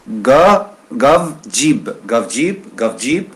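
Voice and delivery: teacher repeating gav and jib; pronunciation drill. Shown as a demonstration. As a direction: drill gav and jib one after another. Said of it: "j'ai dit une fois avec ga, et la deuxième, c'est i".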